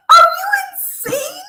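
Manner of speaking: loud, high disbelieving cry; outraged. Real, not acted. Host yelling at the guest in disbelief. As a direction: yell it in disbelief.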